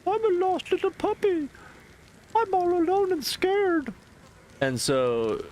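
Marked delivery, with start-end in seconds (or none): 0.1-3.9 very high whimpering puppy-dog voice; squeaky, pathetic little lost dog; pitiful lost puppy. Retold or imitated. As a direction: beg for pity like a stray pup. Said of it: "And he's like".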